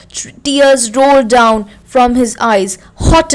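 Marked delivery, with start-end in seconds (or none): none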